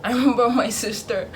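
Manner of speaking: ordinary delivery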